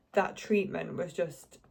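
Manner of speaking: ordinary talk, nothing marked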